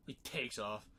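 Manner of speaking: normal talking voice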